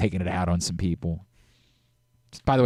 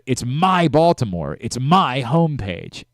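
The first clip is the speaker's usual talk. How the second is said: slow, louder, MY stressed; retelling his ad read with stress on my; the famous ad line; 3 semitones higher, 5 dB louder, slower than usual. Retold or imitated. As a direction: lean hard on my in It's my Baltimore. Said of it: "famously, it was".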